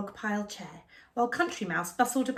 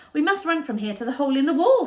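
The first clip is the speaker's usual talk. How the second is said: fast, louder hurried mouse; urgent; 5 semitones higher, 8 dB louder, faster than usual. Acted. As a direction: rush the order. Urgent escape instruction.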